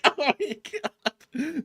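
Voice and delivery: very high, very slow drawn-out gasp; mock awe. Reacting to Cuba's response, slow high mock awe. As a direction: stretch it out in mock amazement.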